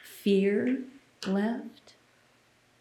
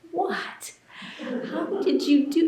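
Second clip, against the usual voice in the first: much higher, astonished outcry; amazed friends; 8 semitones higher than usual. Retold or imitated. Imitating amazed friends asking how she scored, high and astonished. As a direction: voice the stunned friends, pitch jumping up.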